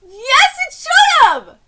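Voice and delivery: high, loud shout; shouting at the wife's excuse; indignant shout. Real, not acted. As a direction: yell it at the screen. Yelling agreement at story.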